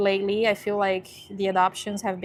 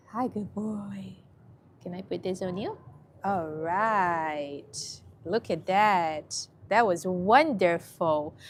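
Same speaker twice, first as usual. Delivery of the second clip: slow, gentle praise; soothing dog talk; slower than usual. Real, not acted. Coaxing a scared dog into the leash, soothing. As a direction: draw the words out tenderly.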